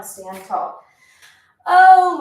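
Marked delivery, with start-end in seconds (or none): 1.7-2.2 sudden exclaim. Real, not acted. Exercise burn sets in.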